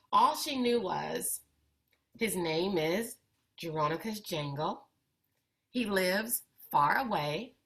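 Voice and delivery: put-on expressive read-aloud for kids, lively and clear; picture book reading. A narrator's reading. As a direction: bring the story to life for young listeners. Reads picture book to children.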